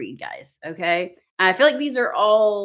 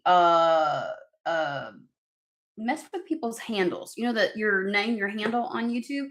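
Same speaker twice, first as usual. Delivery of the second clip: ordinary talk, nothing marked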